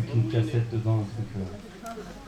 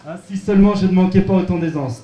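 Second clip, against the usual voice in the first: louder, higher, in one breath; all in one breath; 6 semitones higher, 9 dB louder than usual. Pretend. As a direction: restart loud without pausing. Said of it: "Donc un texte sans respirer".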